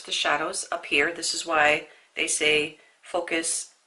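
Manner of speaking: usual speaking voice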